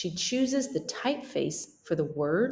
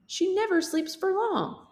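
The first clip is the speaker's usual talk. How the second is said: high hen voice; 13 semitones higher than usual. Acted. Hen character voice.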